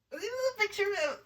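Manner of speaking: high, fast cooing; cooing at cute picture. Real, not acted. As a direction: gush over something cute.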